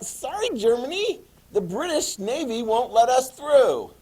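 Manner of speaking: high, sing-song mock-sorry voice; high-pitched fake sympathy; mock apology. Voices Americans apologising to Germany.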